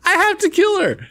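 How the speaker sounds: high, startled disbelief; sudden dawning shock; shocked realization. Real, not acted. Realizing she must kill her.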